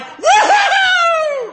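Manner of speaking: high, loud drawn-out whoop; cheering whoop. Retold or imitated. Friends cheering the jump, loud mimicked whoop. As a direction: whoop like a crowd of celebrating friends.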